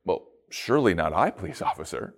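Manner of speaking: low, fading, sheepish; student answering the officer, sheepish; mock innocent reply. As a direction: mumble it back with fake innocence. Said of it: "stand up and go".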